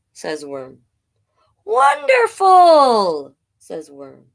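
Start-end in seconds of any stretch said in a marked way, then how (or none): 1.6-3.3 very high, slow, loud wonderful; delighted shout. Acted. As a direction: burst out with joy. Worm exclaims.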